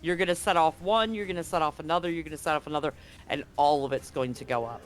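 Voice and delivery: ordinary talk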